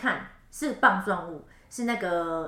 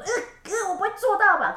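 worried; 11 semitones higher, 5 dB louder than usual. Retold or imitated. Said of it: "他想说".

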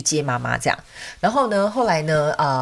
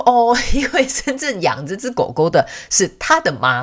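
high, amused realisation with a laugh; retold laughing; 4 semitones higher, 4 dB louder than usual. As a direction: imitate an owner laughing while explaining. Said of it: "然后后来这个主人就一直笑".

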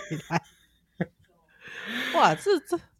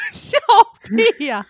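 ordinary talk, nothing marked

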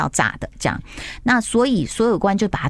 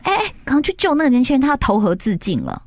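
urgent call for help; 6 semitones higher than usual. Retold or imitated. Bound official calls for rescue.

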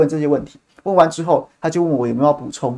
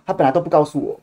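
ordinary delivery

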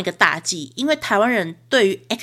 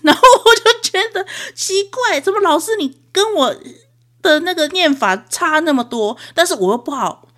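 normal talking voice

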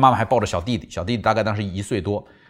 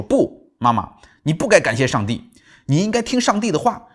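put-on higher, louder, a kid protesting to his mom; boy arguing back; 7 semitones higher, 3 dB louder than usual. Boy argues with his mother after their escape, higher and louder. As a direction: insist like a stubborn nine-year-old. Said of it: "跟他妈妈争辩说".

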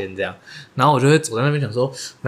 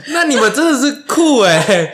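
high, slow words through laughter; can't stop laughing while you say it; laughing reply; 13 semitones higher, 5 dB louder, slower than usual. Real, not acted. Friend reacting to the sister, laughing.

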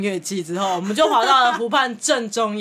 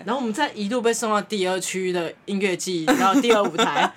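ordinary delivery

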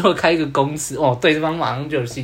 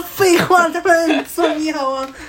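拉高嗓门、放慢，一副废话还用问的口气; 尖声理直气壮地抢白; 理所当然的学生; 12 semitones higher, 5 dB louder, slower than usual. Retold or imitated. 学生理直气壮回答. (学生被老师追问时高声理直气壮地回嘴)

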